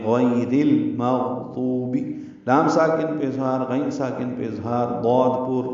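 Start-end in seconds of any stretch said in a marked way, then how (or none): 0.1-2.0 غیر joined into المغضوبی, drawn out slowly; careful tajweed demo of غیر المغضوبی; slow model recitation. Shown as a demonstration. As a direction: read غیر المغضوبی slowly, linking the را to the لام. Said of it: "را کو لام سے ملا دیں گے".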